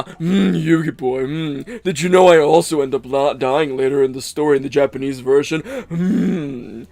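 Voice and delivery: hammed-up dub villain with purring "mm"s; affected playboy villain voice, humming between phrases; Maximilian Pegasus. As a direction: tease like a mannered cartoon villain. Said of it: "why I'm making that voice".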